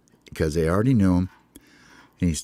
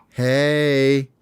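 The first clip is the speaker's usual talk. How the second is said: lightly raised, smooth hey; Hope's casual hey; 5 semitones higher, 3 dB louder than usual. Acted. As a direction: play it cool to the girl. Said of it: "and he goes".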